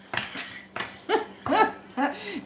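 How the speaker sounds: high giggling laugh. Real, not acted. Laughing at her own shout to the boxer. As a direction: crack up after the joke. Laughing after mock call.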